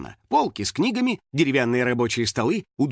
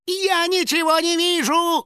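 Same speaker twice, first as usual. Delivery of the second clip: very high, drawn-out panicked wail; screaming; slower than usual. A narrator's reading. House spirit riding blind through corn, screaming. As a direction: shriek it, losing control. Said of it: "вопил домовой".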